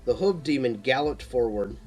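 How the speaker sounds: usual speaking voice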